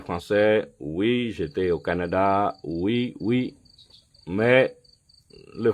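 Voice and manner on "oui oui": ordinary delivery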